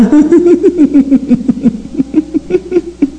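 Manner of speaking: long, high amused laughter; helpless run of giggles. Real, not acted. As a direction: break into a long laugh at your own story. Laughs at her funny house.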